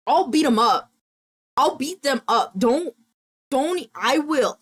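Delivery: fired up, ready to fight; mad on friend's behalf. Real, not acted. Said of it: "I'm so mad".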